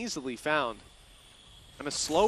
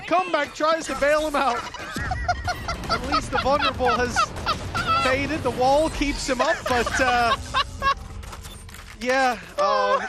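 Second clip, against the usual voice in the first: higher, hyped-up play-by-play; esports caster; 8 semitones higher than usual. Acted. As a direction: keep the hype going through the call. Hyped play-by-play after big moment.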